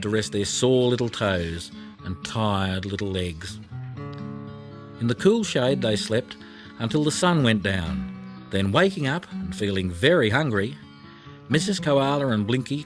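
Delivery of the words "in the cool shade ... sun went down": usual speaking voice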